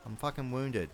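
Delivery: ordinary delivery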